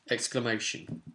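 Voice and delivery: ordinary delivery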